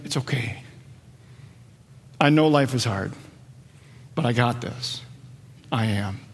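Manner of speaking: hushed, low and tender, with long pauses; gentle reassurance. Retold or imitated. Preacher voices Jesus to the afraid, gently reassuring. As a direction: soothe them softly, letting each phrase settle.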